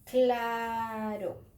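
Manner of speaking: "claro" with a long, held "aaa"; drawn-out word. Shown as a demonstration. Teacher presents the example word to children, drawn out. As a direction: stretch the first vowel of "claro" slowly. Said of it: "La palabra".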